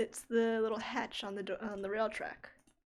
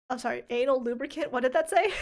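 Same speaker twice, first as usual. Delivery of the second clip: loud, higher, fast disbelief; surprised; 3 semitones higher, 10 dB louder, faster than usual. Real, not acted. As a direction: blurt it out in shock. Reads startling item name.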